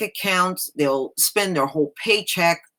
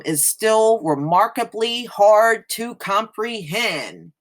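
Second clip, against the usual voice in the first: higher, building rhetorical read of a news piece; emphatic article reading; 5 semitones higher than usual. Acted. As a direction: read it like an impassioned op-ed. Reads article with rising emphasis.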